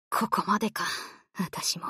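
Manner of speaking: resigned last words; dying murmur; fading resignation. Acted. Sinking resignation.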